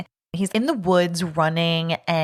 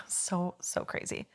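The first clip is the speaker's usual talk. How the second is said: whispered aside; 13 dB quieter, slower than usual. Real, not acted.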